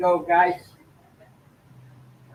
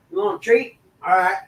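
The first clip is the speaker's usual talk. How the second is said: talking to a dog; 8 dB louder than usual. Real, not acted. Offering the dog a treat.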